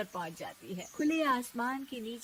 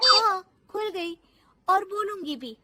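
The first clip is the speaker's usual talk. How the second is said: high-pitched, punchy comeback; cheeky bahu standing up to her; defiant; 8 semitones higher, 9 dB louder than usual. Acted. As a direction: answer back boldly in a raised voice. Bahu defiantly answers back.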